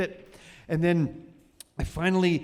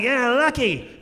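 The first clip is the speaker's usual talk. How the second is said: loud, raised pirate cry; hammed-up pirate voice; pirate captain; 9 semitones higher, 6 dB louder than usual. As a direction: bellow it like a comic pirate. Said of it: "I just do pirate".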